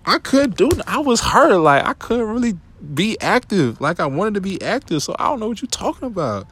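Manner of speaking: high-pitched, wounded and worked up; hurt exasperation. Retold or imitated. Defending himself to the girl who left, high and hurt. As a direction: let the hurt push the voice way up. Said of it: "I'm just like".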